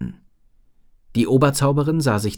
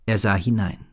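usual speaking voice

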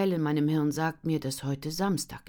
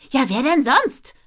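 loud, high snappy bark; snapping; 11 semitones higher, 13 dB louder than usual. Acted. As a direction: snap it back, annoyed. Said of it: "blafft sie".

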